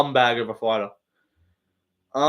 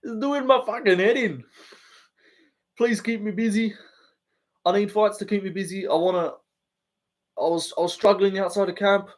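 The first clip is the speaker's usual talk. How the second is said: higher Australian fighter voice with a breathy whispered plea; Volk impression; 5 semitones higher than usual. Streamer tries an Australian fighter impression for chat, half-working. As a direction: attempt at a different Australian fighter's voice. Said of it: "Volk impression".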